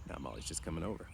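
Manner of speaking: usual speaking voice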